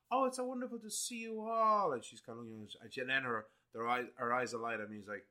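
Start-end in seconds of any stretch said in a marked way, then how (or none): 0.1-1.9 gracious French madame. Acted. Madame greets old friends.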